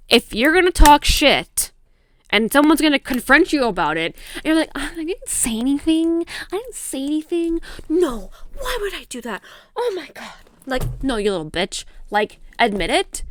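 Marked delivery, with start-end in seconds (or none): 4.7-10.3 high, soft, whiny imitation; mock innocent denial. She mocks someone confronted about gossip, feigned innocence. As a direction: play the coward feigning innocence, pitched way up.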